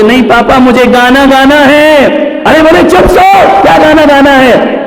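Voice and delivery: put-on child and father yelling high and loud; shouted quarrel. Father and son yelling at midnight over singing, loud. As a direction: escalate into a late-night shouting match.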